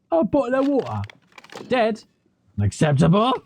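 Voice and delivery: high, hammed-up mock British accent; terrible British accent. Player mocking the English caller in a silly British accent. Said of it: "is he gonna have a terrible British accent?".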